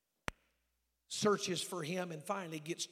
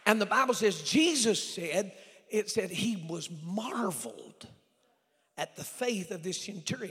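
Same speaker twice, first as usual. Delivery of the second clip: emphatic preaching; 3 semitones higher, 10 dB louder than usual. Real, not acted. Preacher telling congregation how Jesus marveled, emphatic preaching.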